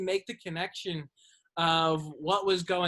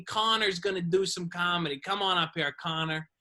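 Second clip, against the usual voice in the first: usual speaking voice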